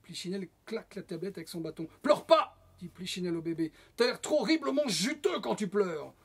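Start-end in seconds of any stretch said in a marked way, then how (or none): 2.0-2.6 high, very loud command; sudden bellowed order; sharp scolding shout. A narrator's reading. Puppet scolds crying baby. 4.0-6.1 higher, louder puppet outburst; swooping, raised-voice tirade; loud scolding. A narrator's reading. Puppet scolds crying baby.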